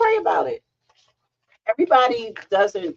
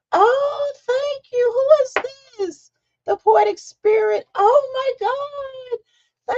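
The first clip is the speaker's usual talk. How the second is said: high, loud squealing cry of joy; real thrilled greeting; excited shout; 4 dB louder, much slower than usual. Real, not acted. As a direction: shriek with delight at a new viewer. Viewer joins the live chat.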